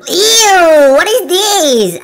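disgusted. Real, not acted. Watcher grossed out by the strange character, disgusted. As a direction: recoil and drag the words out. Disgusted by what looks like poop.

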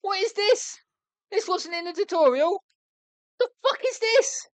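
high-pitched, incredulous squeal; squeaky, frustrated confusion; exasperated bafflement. Real, not acted. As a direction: voice shoots up in disbelief. Baffled by missing tutorial step.

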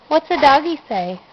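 usual speaking voice

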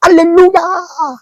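high, drawn-out shouted hallelujah; mock loud praise shout. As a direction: shout it like the loudest worshipper at prayer meeting. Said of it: "malalakas sumigaw". (pasigaw na hallelujah, mataas at mabagal)

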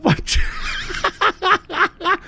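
high staccato laughing; real high-pitched laughing burst. Real, not acted. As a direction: crack up mid-shout. Cracks up amid the quarrel.

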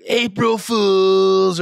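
high, slow sing-song April Fools; teasing kid. Retold or imitated. Father imitates daughter revealing the prank, slow high sing-song. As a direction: stretch it out like a gloating child. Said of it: "And then she's like".

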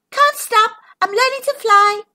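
high, fast excited call; breathless high ladybug; cried out. Acted. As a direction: shout it while zooming past. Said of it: "she cried".

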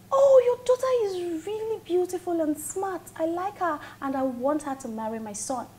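high, loud gushing quote; exaggerated high-pitched praise; imitating Kester's mother. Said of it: "Kester's mother says".

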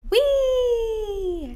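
high, loud squeal of glee; gleeful whee. Acted. Letter D squealing with glee up the tree. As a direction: squeal with delight.

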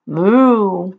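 lightly voiced moo; cow moo. Shown as a demonstration. Teacher shows kids how cows sound. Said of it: "They say".

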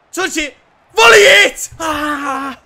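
high, cracking excited scream; frantic fan scream; screaming at a chance. Real, not acted. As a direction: scream for him to shoot, then groan.

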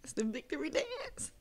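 breathy celebratory whisper; hushed excited whisper; whispered victory. Real, not acted. Celebrating the movie hero's win.

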